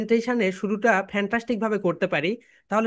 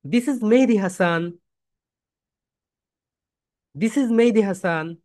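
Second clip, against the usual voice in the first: slow, "Mehedi" louder and drawn out; presentation self-introduction; 3 dB louder, slower than usual. Shown as a demonstration. Teacher leads students in introducing themselves, stressing "Mehedi". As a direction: swell on "Mehedi", measured pace. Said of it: "সবাই বলি".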